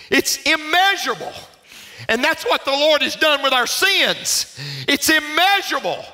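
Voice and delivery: high preacher cry, building to a climax; impassioned preaching. Real, not acted. Preacher proclaiming sins forgiven, impassioned and building. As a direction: build to a ringing shout at the end.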